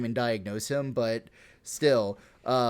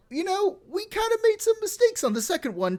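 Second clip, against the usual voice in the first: much higher, sheepish mock apology; squeaky mock delegate. Polish delegate apologizing again, high sheepish voice. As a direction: squeak out the excuse. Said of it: "the Polish delegation came back and said".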